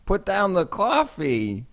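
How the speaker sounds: high, loud, slow mock New Jersey accent. Retelling the sound man's bad New Jersey impression, loud and hammed-up. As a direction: bark it like a hammed-up Jersey guy. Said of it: "our sound man Martin's impression".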